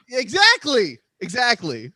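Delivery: loud, high burst of agreement; emphatic outburst. Real, not acted. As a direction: shout it as if your point is proven. Exasperated host seizes on the point.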